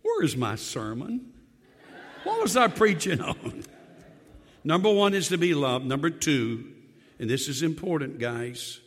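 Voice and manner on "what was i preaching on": laughing. Real, not acted. Laughing after joke.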